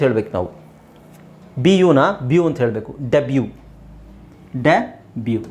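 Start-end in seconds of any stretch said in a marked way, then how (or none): none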